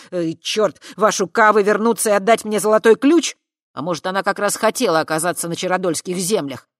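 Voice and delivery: loud, rapid angry tirade; heated outburst. A narrator's reading. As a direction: blurt it all out in one breath. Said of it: "выпалил длинную тираду практически на одном дыхании".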